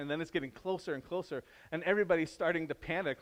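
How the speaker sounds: ordinary talk, nothing marked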